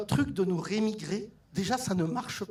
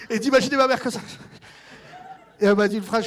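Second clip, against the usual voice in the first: fast and loud through laughter; giggling as he pictures it; laughing; 7 dB louder, much faster than usual. Real, not acted. Comedian to audience, laughing at mother's state.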